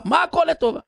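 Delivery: high-pitched indignant retort; retold annoyed king. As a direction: throw the words back in mock outrage. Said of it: "המלך התעצבן". (מלך עצבני בחיקוי, בטון גבוה)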